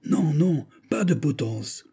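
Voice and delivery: high-pitched calling out; crowd cry. A narrator's reading. The majority of the crowd protests at the gallows, crowd cry. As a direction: cry out as the voice of the mob. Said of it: "cria la majorité".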